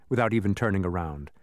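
plain reading voice

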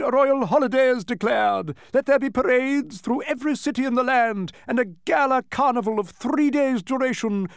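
high, ringing herald's voice, slowing on the last words; grand proclamation. A narrator's reading. Duke proclaims a holiday for the victory, grand declaiming. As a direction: declaim a royal holiday to the crowd. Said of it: "concluded the Duke".

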